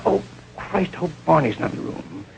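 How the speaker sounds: whispered under the breath; whispered worry. Retold or imitated. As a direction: breathe it out in dread. Inner thought on seeing trouble.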